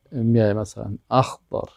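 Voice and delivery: ordinary delivery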